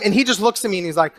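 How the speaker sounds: usual speaking voice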